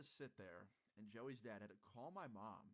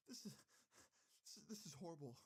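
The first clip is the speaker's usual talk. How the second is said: high, broken mock sobbing; halting, high-pitched tearful impression; imitated hysterical crying; 7 semitones higher, faster than usual. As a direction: mimic a mom struggling to get words out between sobs. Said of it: "hysterically crying".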